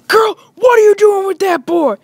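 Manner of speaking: high, loud put-on scolding voice; scandalized parent. Parent catching girl with a boy, scandalized shout. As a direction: demand it like an outraged parent.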